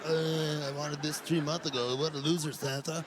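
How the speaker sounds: put-on low, sulky bratty kid voice. Kid's dismissive reply voiced.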